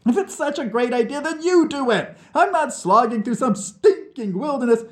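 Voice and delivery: high, loud, indignant retort; angry refusal. Acted. Nikita refusing to slog through wilderness for Vlad, angry. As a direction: throw the idea back at him hotly.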